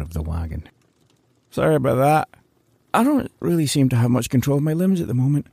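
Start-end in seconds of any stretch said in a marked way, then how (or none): 1.5-5.5 woozy Larry. Acted. Larry apologizes, drugged.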